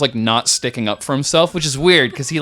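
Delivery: ordinary talk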